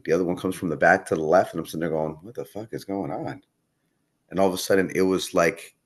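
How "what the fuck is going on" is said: retold soft, puzzled aside; bewildered aside. Puzzled fighter to himself as more police arrive, soft aside. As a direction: drop the volume, as if asking yourself.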